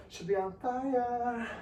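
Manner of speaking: slow, high, stretched-out wail; high, drawn-out strain. Real, not acted. Trainer telling viewers quads should burn, high and drawn-out. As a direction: hold "fire" long and high.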